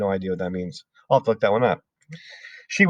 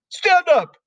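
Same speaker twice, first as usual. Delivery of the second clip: much higher, louder bark of a name; 6 dB louder than usual. Acted. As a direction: snap the girl's name out. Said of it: "she barked".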